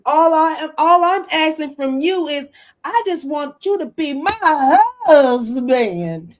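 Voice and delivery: mock-insist on wanting her husband; imitating the wife demanding a husband; pleading outburst. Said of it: "she said".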